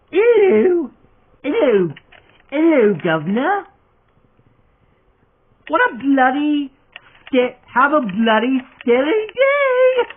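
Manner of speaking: high, loud British accent greeting. Shown as a demonstration. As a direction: put on a hammy British accent. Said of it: "try doing my own voice impersonation of [name], and by that, I mean talking in my own British accent".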